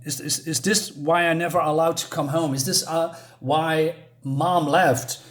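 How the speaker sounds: ordinary delivery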